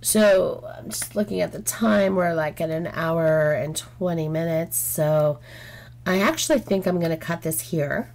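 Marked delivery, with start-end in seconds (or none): none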